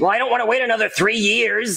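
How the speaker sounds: high-pitched whining objection; whiny protest. Acted. Cody objects to waiting years for his friends, whining high. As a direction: protest like you're being left out. Begging not to be left behind.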